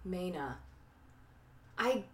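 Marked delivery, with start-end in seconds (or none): 0.1-0.6 put-on soft, R-less Maine accent on "Mana"; echoed New England Maine accent, softer. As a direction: quietly try out a Maine accent. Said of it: "like, New Englandy".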